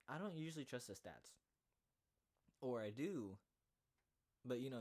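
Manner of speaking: ordinary delivery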